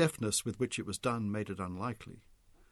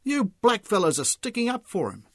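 loud, higher accusation; angry accusation; 9 semitones higher, 7 dB louder than usual. A narrator's reading. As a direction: accuse them hotly.

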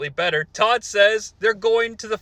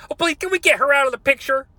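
higher, quick whiny Cornette; mock Cornette; 7 semitones higher, faster than usual. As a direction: whine it like Cornette. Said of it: "that's Cornette".

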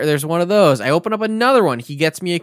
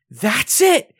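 higher, louder final verdict from an angry father; fed-up dad; 9 semitones higher, 4 dB louder than usual. Retold or imitated. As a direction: lay down the law, done with it. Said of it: "he is pissed".

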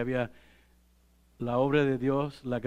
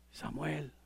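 much softer, a far-off call of a name; faint voice calling; 12 dB quieter than usual. A narrator's reading. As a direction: call Samuel softly, as if from afar. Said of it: "Otra vez". (voz de Dios llamando a Samuel, suave y lejana)

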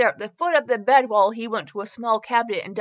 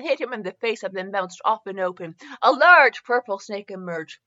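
ordinary talk, nothing marked

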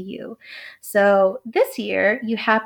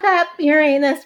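high, louder giggly "Uranus" as yer-AY-nus; giggling; 8 semitones higher, 5 dB louder than usual. Real, not acted. Astrologer unable to say the silly version straight, giggling. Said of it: "giggling like a schoolgirl".

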